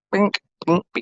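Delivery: high, clicking bink bink of a blinker; playful blinker noise; turn signal sound. Shown as a demonstration. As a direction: mimic a car's turn signal ticking. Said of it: "all you gotta do is".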